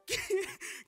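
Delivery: high, loud burst of laughter; short high cackle. Real, not acted. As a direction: crack up suddenly. Reacting to on-screen warning.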